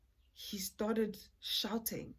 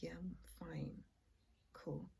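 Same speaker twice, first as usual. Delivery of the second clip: whispering; 13 dB quieter than usual. Retold or imitated. Quiet reply at the door.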